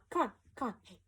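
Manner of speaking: normal talking voice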